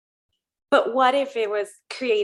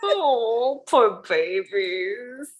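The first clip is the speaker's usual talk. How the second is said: sympathetic; much slower than usual. Real, not acted. Pity for the shuffled dogs.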